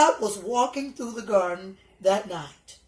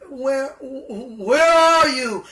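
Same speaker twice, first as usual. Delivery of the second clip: very slow, loud, higher drawn-out call; echoing call of God; 3 semitones higher, 7 dB louder, much slower than usual. Acted. God calling for hiding Adam and Eve, slow echoing call. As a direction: stretch each where like calling across the garden. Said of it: "asked God".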